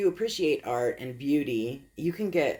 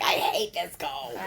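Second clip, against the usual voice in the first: high, drawn-out whine about the cold; mock complaint; 7 semitones higher than usual. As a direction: exaggerate hating the cold, higher and slower.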